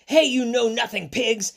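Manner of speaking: high, punchy storybook yell. Acted. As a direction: yell it out at the pigs. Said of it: "and yelled".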